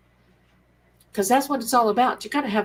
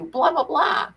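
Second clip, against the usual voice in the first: mocking blah; 7 semitones higher than usual. Dismissing the critics.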